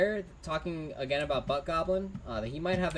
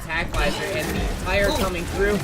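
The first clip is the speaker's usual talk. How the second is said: live play-by-play; 2 semitones higher, 7 dB louder than usual. Acted. Caster calling the fight to viewers, louder and building. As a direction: build the fight call toward the ult.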